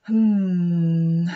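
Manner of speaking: a long loud thoughtful hum; deep long hmm. Acted. Lion Lucinda ponders Monty's losses with a long hmm. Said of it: "let out a deep, long".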